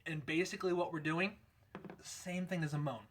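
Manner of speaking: normal talking voice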